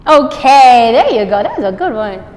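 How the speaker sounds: bright approving praise; praise to the dog. Real, not acted. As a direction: reward her with your voice. Praising dog after cue.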